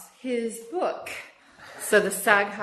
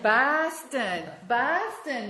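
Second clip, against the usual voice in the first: Boston accent; 4 semitones higher, much slower than usual. Shown as a demonstration. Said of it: "that Massachusetts accent, that's just how they talk there".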